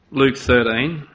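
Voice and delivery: normal talking voice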